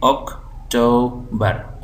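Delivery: October slowly as OC, to, ber; syllable by syllable. Shown as a demonstration. Teacher spelling aloud from memory, October slowly split. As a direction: sound out October piece by piece. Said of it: "आप ज़बानी".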